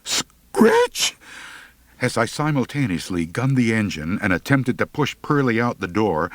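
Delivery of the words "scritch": each Scritch higher, last a panicked squeak; a boy panicking at a feared name; rising panic. Acted. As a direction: climb into terrified disbelief. Boy realizes who lives there.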